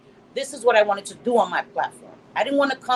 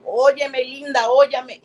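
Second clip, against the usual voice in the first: louder Spanish "Óyeme, linda" with a playful lilt; mock Spanish flirt; 4 semitones higher, 5 dB louder than usual. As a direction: sell the Spanish pickup line. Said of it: "It doesn't have the same".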